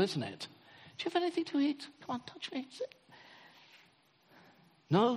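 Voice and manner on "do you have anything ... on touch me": high, soft, coaxing invitation; invitational Jesus. Shown as a demonstration. Jesus inviting the frightened disciples, high soft coaxing. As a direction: lightly coax them closer, much higher and softer. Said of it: "this is so invitational".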